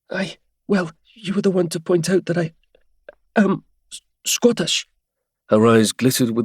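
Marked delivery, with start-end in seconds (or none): 0.1-4.9 Helia voice. Acted.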